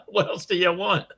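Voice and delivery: usual speaking voice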